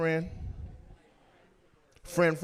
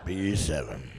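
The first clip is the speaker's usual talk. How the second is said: bingo caller; 13 semitones lower, slower than usual. Retold or imitated. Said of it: "call out a bingo".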